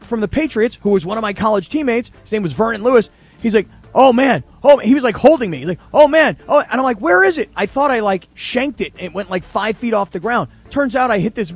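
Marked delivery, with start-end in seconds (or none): 3.9-4.8 loud, high "oh man"; a rush of amazement; excited exclaim. Retold or imitated. Teammate reacts to huge kick. 5.9-6.6 loud, high "oh man"; a burst of amazement; excited exclaim. Retold or imitated. Teammate reacts to huge kick. 7.0-7.5 high, urgent question; a searching, baffled cry; confused alarm. Retold or imitated. Kicker can't see his ball.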